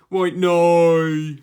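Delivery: very loud, high, drawn-out cry; loud mock exclamation. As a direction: bellow it, imitating a friend. Acts friend calling out.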